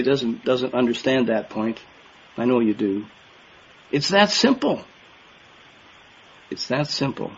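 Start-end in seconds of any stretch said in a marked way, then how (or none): 3.9-4.8 high emphatic. Shown as a demonstration. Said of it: "repeated for emphasis". 6.5-7.3 quiet repeat. Shown as a demonstration. Preacher quietly echoing his own point to the brethren, soft. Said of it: "repeated for emphasis".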